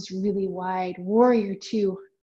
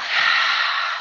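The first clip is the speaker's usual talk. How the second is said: big loud breathy sigh; loud exhale sigh; 5 dB louder than usual. Shown as a demonstration. Teacher sighing loudly for kids. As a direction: blow it all out.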